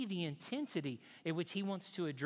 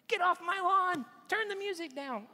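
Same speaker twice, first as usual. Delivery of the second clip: put-on high, loud cranky old man shouting; angry old man; 14 semitones higher, 7 dB louder than usual. Bystander imagines an angry old man, mock cranky yell. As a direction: play the grumpy neighbor yelling at kids. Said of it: "That is an angry old man".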